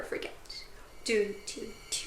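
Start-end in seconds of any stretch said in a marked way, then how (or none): none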